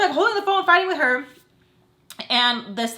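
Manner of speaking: usual speaking voice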